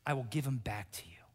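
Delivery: a breathless whisper of the vow. Acted. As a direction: drop to a whisper, a promise. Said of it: "crying out in desperation".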